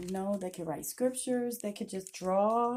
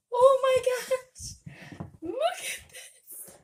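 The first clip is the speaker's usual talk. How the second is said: very high, loud, slow delighted squeal; excited squeal; 7 dB louder, much slower than usual. Real, not acted. To viewers, unboxing her own books: thrilled squeal. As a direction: shriek it with real joy at the package. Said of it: "But I am just so excited".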